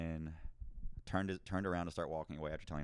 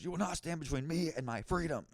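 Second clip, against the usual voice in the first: higher, grand mock-heroic proclamation; mock defiant declaration; 8 semitones higher than usual. Sovereign citizen storming past security, hammed-up defiance. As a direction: declaim it like a self-styled freedom fighter.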